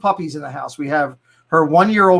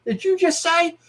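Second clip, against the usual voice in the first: retold protest; 10 semitones higher, 3 dB quieter than usual. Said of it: "I turned to her and said".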